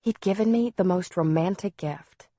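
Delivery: usual speaking voice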